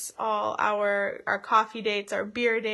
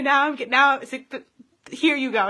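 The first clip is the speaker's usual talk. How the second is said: getting emotional; 4 semitones higher, 8 dB louder than usual. Real, not acted. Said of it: "Now I'm getting just a little bit emotional".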